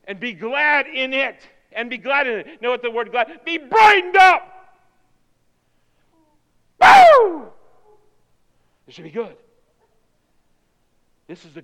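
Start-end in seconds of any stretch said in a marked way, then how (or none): 3.4-4.8 loud, high, slow cheer; exuberant exhortation. Shown as a demonstration. Exhorting what glad means. 6.8-7.5 high, loud woo; exuberant whoop. Acted. Celebrating being glad.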